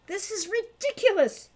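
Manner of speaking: loud, high, exasperated yell; angry boy shouting; frustrated shout. Acted. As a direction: shout it at the locked door. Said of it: "he shouted".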